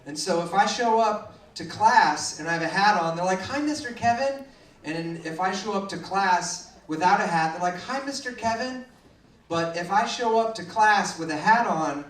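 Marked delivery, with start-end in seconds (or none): 3.4-4.5 lightly voiced higher-pitched kids; kids' greeting. Kids greeting teacher in story. 7.8-8.9 lightly voiced kids, higher and softer; kids' greeting. Kids greeting teacher in story.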